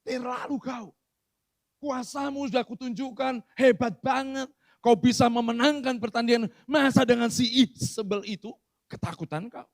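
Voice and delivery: put-on high, loud Batak-accented rebuke; a blunt Batak rebuke, full force; Batak style. Pastor voices a Batak God scolding fearful Elia. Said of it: "ini gaya Batak ini".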